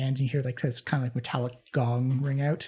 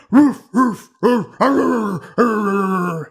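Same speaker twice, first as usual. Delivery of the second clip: barking sounds; 5 semitones higher, 8 dB louder, much slower than usual. Retold or imitated. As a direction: bark out loud like a big dog.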